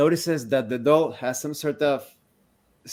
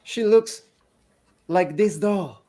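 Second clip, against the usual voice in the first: higher, fragile voice of the mother; grieving mother; 6 semitones higher, 4 dB louder than usual. Acted. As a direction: offer the doll as proof. Woman answers description question.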